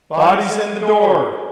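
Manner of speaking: high, very loud, slow call. Pretend. Guard-style call of room checks, very loud slow call. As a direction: bellow it down the hall.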